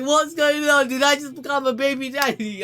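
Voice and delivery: high, loud flustered voice; hammed-up panic at sudden fatherhood; comic bewilderment. Acted bewildered reaction, jokingly.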